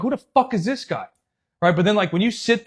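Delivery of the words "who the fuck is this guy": high-pitched incredulous question; raised pitch, mock outrage; retold indignant disbelief. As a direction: replay being baffled by someone. Retold indignant reaction to opponent.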